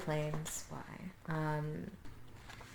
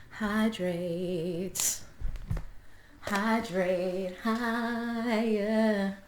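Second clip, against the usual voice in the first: slow stretched pun; 2 semitones higher, much slower than usual. Pretend. Silly punning sign-off.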